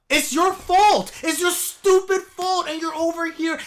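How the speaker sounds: loud, slow, high furious rant; it's your fault, shouted slow and high; angry rant. Real, not acted. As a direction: hammer it home in outrage.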